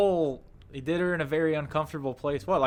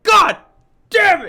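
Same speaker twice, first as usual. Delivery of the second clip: screamed in frustration at full volume; furious shout; 16 dB louder, slower than usual. Retold or imitated. Retelling the sailboat guy's outburst, a furious yell. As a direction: yell it out, way up high. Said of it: "He's like".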